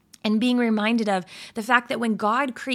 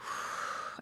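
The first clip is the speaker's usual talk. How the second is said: long unvoiced exhale; relieved sigh; 14 dB quieter than usual. Shown as a demonstration. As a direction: sigh it all out. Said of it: "just to say".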